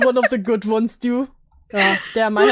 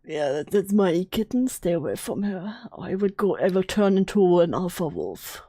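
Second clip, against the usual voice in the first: deep man voice; 4 semitones lower than usual. Pretend. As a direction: play the protective boyfriend in a fake low voice. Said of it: "doing a deep man voice or something".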